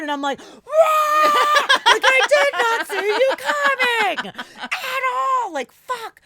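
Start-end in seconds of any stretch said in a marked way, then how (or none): 0.4-1.3 sudden high shriek of fright, much louder; startled scream. Retold or imitated. Reliving being startled by her silent boyfriend, a sudden loud shriek. 1.9-6.2 high, loud, slow words through laughter; shocked laughing. Retold or imitated. Telling her boyfriend he snuck up on her, laughing loudly. Reacting to being startled.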